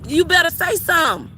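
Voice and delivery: ordinary talk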